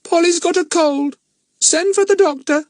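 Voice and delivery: very high, squawking parrot; hollow parrot voice. Acted. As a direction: croak it like a parrot. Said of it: "in a hollow voice".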